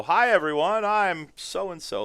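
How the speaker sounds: high, loud, slow announcement; mock pompous hello; self-important greeting. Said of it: "walk in a room and go".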